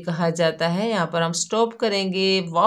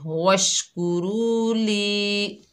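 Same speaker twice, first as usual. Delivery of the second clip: very slow chanted वशकुरू ली, vowels drawn; Quran recitation; 3 semitones higher, much slower than usual. Shown as a demonstration. Teacher recites the joined word for students, slow chanted. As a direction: recite it slowly, stretching each long vowel. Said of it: "जोड़ रवा करते वक्त".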